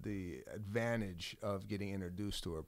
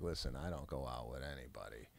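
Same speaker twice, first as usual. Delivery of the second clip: dry sarcasm; 8 semitones lower than usual. Retold or imitated. Wife laying down terms to her gym suitor, dry and sarcastic. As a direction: imitate her very dry, sarcastic delivery, pitched well down. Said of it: "Very sarcastic, very dry".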